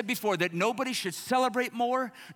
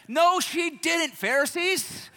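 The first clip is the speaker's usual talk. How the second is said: emphatic retort; 7 semitones higher, 5 dB louder, slower than usual. Acted. Retort to imagined critics.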